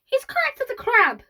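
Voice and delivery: very high, loud squawk of agreement; scandalised, screechy old voice; outraged grandparent. A narrator's reading. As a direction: pipe up in high-pitched indignation. Second grandparent joins the protest.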